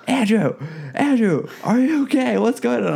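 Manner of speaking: high-pitched imitation of a worried friend; alarmed calling out. Friend on the phone checking on him, alarmed. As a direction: call the name out in mock alarm, high.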